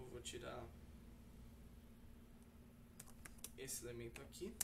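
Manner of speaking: low, soft voice while working; halting, hushed talk to oneself; absorbed self-talk. Real, not acted. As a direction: think aloud quietly while fixing a mistake. Fixing his own error while recording.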